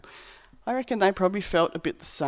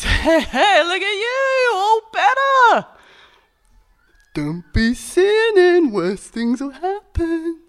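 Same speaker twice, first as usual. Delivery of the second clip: much higher, loud, slow and jolly; cheery Jesus; 12 semitones higher, 8 dB louder, slower than usual. Acted. Jesus to the healed man in the temple, jolly warning. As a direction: beam at him like an old mate. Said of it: "Jesus found him in the temple".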